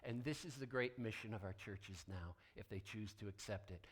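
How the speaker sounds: ordinary talk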